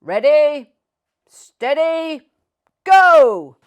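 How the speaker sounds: loud, high race starter's call; shout. A narrator's reading. Man with the flag starting the dog race, loud shout. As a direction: belt out the start like a race official. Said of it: "Then he shouted".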